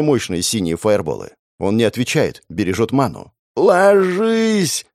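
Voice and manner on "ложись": urgent yell, much higher and loud. A narrator's reading. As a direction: bellow the order to get down. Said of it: "ору я".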